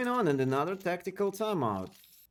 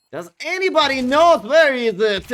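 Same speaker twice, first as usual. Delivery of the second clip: much higher and louder, urgent caster yell; excited shout; 9 semitones higher, 13 dB louder than usual. Real, not acted. Caster yelling to the crowd about the hidden bomb, excited shout. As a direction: shout it out as the bomb goes down unseen.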